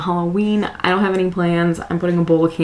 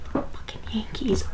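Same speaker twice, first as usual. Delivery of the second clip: whispering; 3 semitones higher, 7 dB quieter than usual. Real, not acted. Whispering while husband sleeps.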